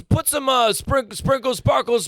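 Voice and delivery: high shouted deli clerk voice; loud bodega clerk. Pretend. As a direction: yell the order across the counter. Clerk voice continues.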